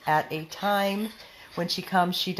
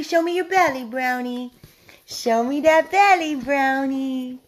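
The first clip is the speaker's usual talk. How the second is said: talking to a dog; 5 semitones higher, 5 dB louder than usual. Real, not acted. Coaxing the dog.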